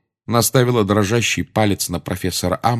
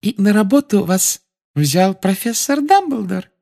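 high, sweet prim official; sickly sweet inquisitor; sugary high Umbridge voice; 12 semitones higher, 3 dB louder than usual. A narrator's reading.

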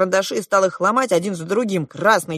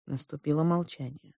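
ordinary delivery

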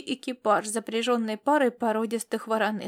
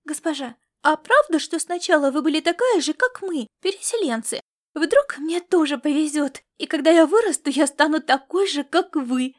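put-on high, loud, eager child; excited childish chatter, higher-pitched; girl blurting dreamily; 5 semitones higher, 5 dB louder than usual. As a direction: blurt it out like a starry-eyed little girl. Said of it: "выпалила она с мечтательным выражением".

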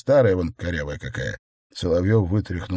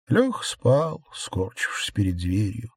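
ordinary delivery

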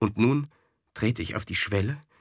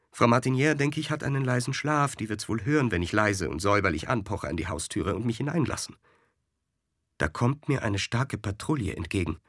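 usual speaking voice

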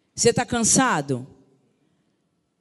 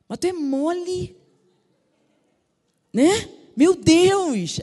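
scornful jab; 7 semitones higher, 4 dB louder, much slower than usual. Retold or imitated. Mocking a slower person.

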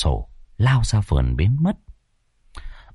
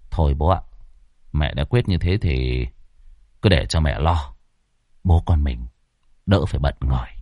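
easygoing son; 7 semitones lower, 4 dB quieter than usual. A narrator's reading. Son answers his father.

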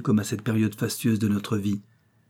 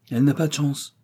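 mildly raised, higher comment; aloud; 5 semitones higher than usual. A narrator's reading. Friend commenting on Janine leaving, aloud. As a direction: say it out for others to hear. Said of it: "commenta l'amie à haute voix".

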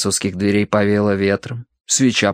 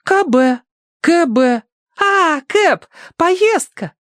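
put-on very high girlish puzzling; Tossie's voice; much slower than usual. A narrator's reading. Tossie guesses the word cab, very high voice. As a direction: work it out aloud in a high voice.

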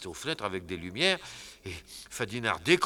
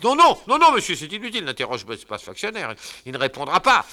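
very high loud panicked no; panicked shout; 9 semitones higher, 13 dB louder, faster than usual. Acted. Fadinard stopping the husband, shouting in panic. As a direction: cut him off in a panic.

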